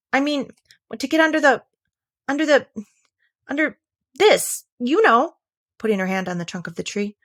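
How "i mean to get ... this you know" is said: higher, halting search for the word; groping for a word. Acted. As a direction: stall as the word slips away. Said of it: "rather surprised at not being able to think of the word".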